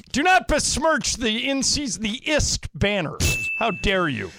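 high, playfully outraged; mock indignation. Host defending the tournament banner, mock indignation.

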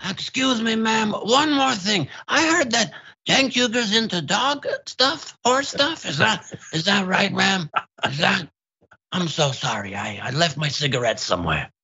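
high, rambling rumpled detective voice; the host does his Columbo bit for the co-host, pitched high; Columbo impression. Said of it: "I now have a full-on Columbo".

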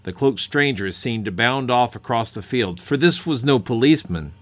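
dramatic story narration. A narrator's reading. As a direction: tell it like a spooky legend. Dramatic cold open narration.

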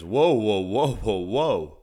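loud astonished whoas; a big surprised double take; startled repeated whoa, voicing a football player; amazed whoa. Adult star reacts in amazement.

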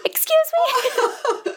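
high, slow, incredulous laugh-cry; a laughing, shocked "excuse me"; laughing disbelief. Real, not acted. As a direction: squeal it out through laughter in disbelief.

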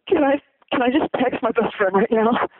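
imitating an overwhelmed, near-tearful fan, high-pitched; high mock-tearful plea; practically crying fan. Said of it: "and practically crying".

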